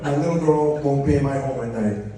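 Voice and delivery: ordinary talk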